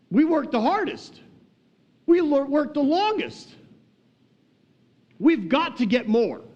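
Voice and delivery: high, drawn-out insistence; eager self-assurance. Acted. All-day workers expecting a bonus, acting eagerly insistent.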